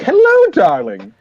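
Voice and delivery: hammed-up cozying up to an old lady; over-familiar charmer. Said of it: "nestles in real close. He's like".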